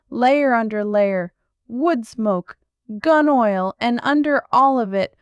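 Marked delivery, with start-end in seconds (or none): none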